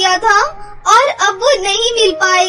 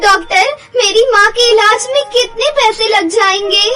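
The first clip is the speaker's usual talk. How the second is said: high, loud, crying plea; tearful panic; 6 semitones higher, 3 dB louder than usual. Acted. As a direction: beg the doctor through tears. Anjali hears mother in coma.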